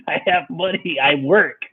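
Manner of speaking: high, half-laughed insistence; laughing mock protest. Bleeding kid arguing with police, laughing protest. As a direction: insist through giggles.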